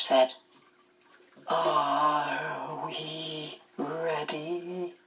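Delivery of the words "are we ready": very slow, eerie, distant and softer; Mrs Which voice. A narrator's reading. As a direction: drag out each word like a ghostly witch. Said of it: "Missus Witch spoke".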